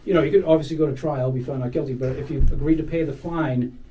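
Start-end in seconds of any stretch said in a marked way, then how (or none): none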